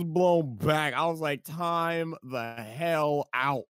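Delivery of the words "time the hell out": stunned disbelief. Retold or imitated. Blown back by the reveal.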